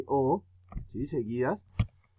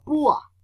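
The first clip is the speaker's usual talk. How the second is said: "púa" with a strong U, weaker A; "púa", emphasis on the first vowel, U; stress on U; 11 semitones higher, 4 dB louder than usual. Shown as a demonstration. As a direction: hit the U in "púa". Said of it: "suena más duro la U".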